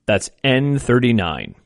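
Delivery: slow, drawn-out number calling; bingo caller. Acted. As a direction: call each number slow and clear.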